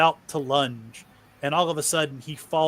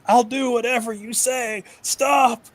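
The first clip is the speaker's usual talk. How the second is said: high, desperate pleading wail; a broken man begging his master to stop; 11 semitones higher, 3 dB louder than usual. Acted. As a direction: beg in terror, voice pitched way up. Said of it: "Mark starts wailing".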